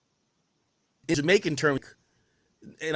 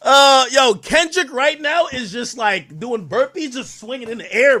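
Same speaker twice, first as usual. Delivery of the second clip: higher, louder, slowed hype; excited yelling; 9 semitones higher, 9 dB louder than usual. Real, not acted. Streamer hyping up the [name] clip, excited yelling. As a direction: hype it up like you can't believe it. Hyped describing [name]'s moves.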